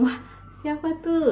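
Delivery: put-on high, bright surprised wondering to a child; curious parent. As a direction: sound amazed so the child looks up.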